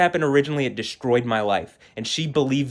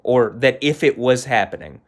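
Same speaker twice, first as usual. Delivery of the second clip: usual speaking voice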